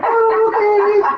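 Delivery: high, slow, drawn-out letdown "oh okay"; mock disappointed. Husband retelling being told there were no meatballs, mock disappointed. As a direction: play it like a kid denied meatballs. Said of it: "And I was like".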